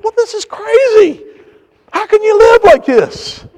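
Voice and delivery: high, slow incredulous voice; high-pitched baffled complaint; retold bewilderment. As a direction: protest in disbelief, drawing it out. Retelling own bewilderment at earthquake.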